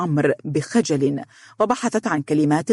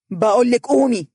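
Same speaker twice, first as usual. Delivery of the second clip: higher, mildly raised snap; irritated shout; 7 semitones higher, 3 dB louder than usual. A narrator's reading. Fiancée ordering the little girl up, irritated. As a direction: order it with rising temper. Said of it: "وصاحت بعصبية".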